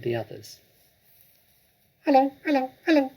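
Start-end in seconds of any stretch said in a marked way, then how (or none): 2.0-3.1 high squawky parrot imitating a human. Parrot greeting the children with a high squawk. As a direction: chirp it out like a talking bird. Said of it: "came the voice again".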